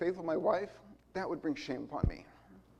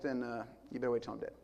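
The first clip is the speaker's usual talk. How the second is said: usual speaking voice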